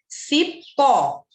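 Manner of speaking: "Cipó" split in two, PÓ strong and long; loud demo, weight on PÓ in "Cipó"; stress on final PÓ. Shown as a demonstration. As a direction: land hard on the last syllable of "Cipó". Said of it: "A última sílaba é a forte".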